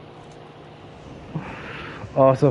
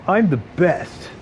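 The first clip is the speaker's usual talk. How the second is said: quoted catchphrase; 4 semitones higher, much slower than usual. Retold or imitated. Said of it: "He'd have to do the line".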